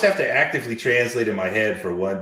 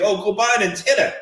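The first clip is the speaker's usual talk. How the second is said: mocking quote; 10 semitones higher, 4 dB louder than usual. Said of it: "they tell everybody".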